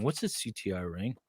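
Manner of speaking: ordinary talk, nothing marked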